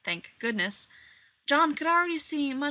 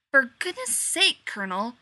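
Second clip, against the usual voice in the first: huffy; 3 semitones higher, 6 dB louder than usual. A narrator's reading. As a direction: huff it out, fed up. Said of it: "Rodney huffed".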